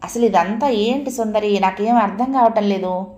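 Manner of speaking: usual speaking voice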